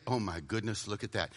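very low, hushed distraction; soft, low murmur to oneself; tempted aside. Acted. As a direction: let it slip out under your breath. Acting out being tempted mid-prayer.

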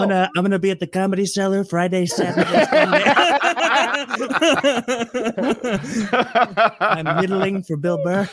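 mock Trump plug with laughs; laughing Trump comic. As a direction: chuckle through a fake club plug.

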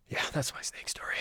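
whispered wrap-up. Real, not acted. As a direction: hush it out as a sign-off. Wrapping up the story.

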